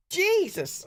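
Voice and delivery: sharp high yelp of pain. Retold or imitated. Reacting to painful jelly.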